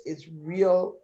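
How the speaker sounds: usual speaking voice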